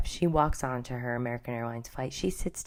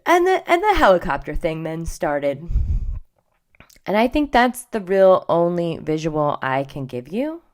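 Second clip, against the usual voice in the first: louder, emphatic telling; 4 semitones higher, 8 dB louder than usual. Real, not acted. Describing the video to viewers, loud and emphatic.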